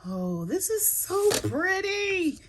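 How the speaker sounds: high, slow swoon; awed delight. Real, not acted. Crafter showing patterned paper to viewers, awed swoon. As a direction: melt over the pretty paper.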